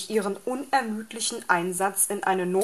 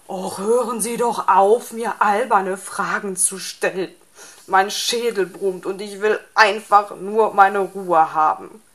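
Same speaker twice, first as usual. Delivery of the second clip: irritated outburst; 7 dB louder than usual. A narrator's reading. Sore fox snaps at owl.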